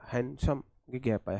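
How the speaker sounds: usual speaking voice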